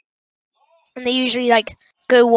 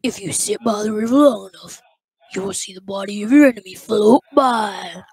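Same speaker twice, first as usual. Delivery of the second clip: Pig Patch; 2 semitones lower, 3 dB louder than usual. Acted. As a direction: play a cartoon animatronic, leaning on key words. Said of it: "but I can do the".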